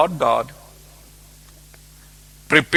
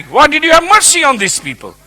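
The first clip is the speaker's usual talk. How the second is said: higher-pitched angry demand to God; angry Jonah; 7 semitones higher, 3 dB louder than usual. Acted. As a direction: demand an answer, mildly angry. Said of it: "The Bible says he was angry".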